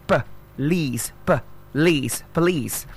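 "Police" split as puh, leece, then joined; syllable drill. Shown as a demonstration. Teacher showing how it's pronounced, broken into syllables. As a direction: sound out "Police" with a tiny first vowel. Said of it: "That's how it's pronounced".

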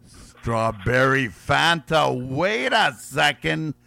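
ordinary talk

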